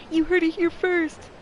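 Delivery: high, loud, slow proclamation; mock dramatic declaration; booming announcer cry. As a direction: announce it like breaking news. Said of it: "my voice crawled out of my diaphragm".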